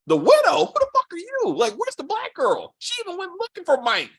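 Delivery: high, stunned "who are you" disbelief; high-pitched mock outrage at the screen; incredulous outrage. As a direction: squeal it out in shocked disbelief. Reacting to the widow appearing.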